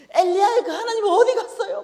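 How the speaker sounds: high, loud crying yell with a laugh; tearful outburst screamed at God; wailing shout. Retold or imitated. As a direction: demand God's answer, half sobbing. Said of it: "막 이러고 난리를 쳤거든요".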